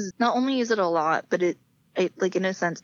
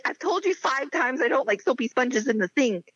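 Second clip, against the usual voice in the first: mock yelling; 6 semitones higher than usual. Woman scolding her fiance over chores, mock yelling. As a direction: scold him as if fed up, pitched up. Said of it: "be yelling at him".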